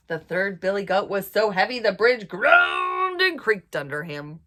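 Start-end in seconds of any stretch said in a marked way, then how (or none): 2.3-3.7 loud, stretched-out "groaned"; groaning emphasis. A narrator's reading. Storyteller showing the bridge straining, loud drawn-out emphasis. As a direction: make the words strain under the weight.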